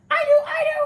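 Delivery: high, loud eager classmate; eager shout. Acted. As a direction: shout it out, hand waving to be picked.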